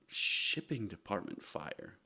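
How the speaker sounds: flat low deadpan echo. Real, not acted. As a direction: say it slow and unimpressed. Echoing the title flatly.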